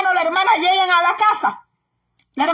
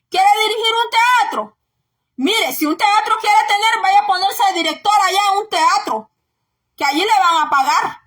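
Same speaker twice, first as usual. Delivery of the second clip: normal talking voice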